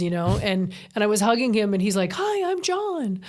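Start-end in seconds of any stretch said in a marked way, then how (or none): 2.1-3.2 high, drawn-out, playful greeting; hammed-up high little-man voice; mimicked little man. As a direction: draw it out high and slow, mock-sweet. Mimics little man met while hugging.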